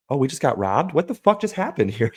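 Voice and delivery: high, rising mock alarm; mock shocked clerk. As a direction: play the clerk who just got robbed, stunned. Clerk shocked after robbery.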